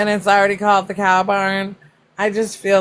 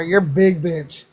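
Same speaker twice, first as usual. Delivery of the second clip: slow, lower, deadpan shopkeeper voice; slow blunt shopkeeper; 3 semitones lower, slower than usual. Retold or imitated. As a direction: drag out the insult flatly. Repeats shopkeeper line slower.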